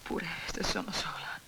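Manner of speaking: hushed, breathy refusal; defiant whisper. Acted. Widow refusing to leave her home, whispering. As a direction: whisper it with quiet resolve.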